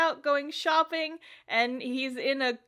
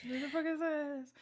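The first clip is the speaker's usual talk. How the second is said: mock puzzled mutter; 10 dB quieter, faster than usual. Sogo puzzled by charm.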